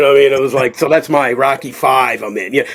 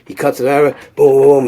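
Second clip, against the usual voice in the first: usual speaking voice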